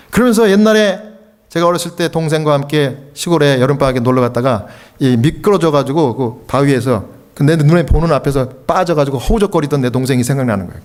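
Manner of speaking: ordinary talk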